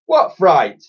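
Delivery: falsely innocent query; mock innocent. A narrator's reading. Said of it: "mock, mock innocently".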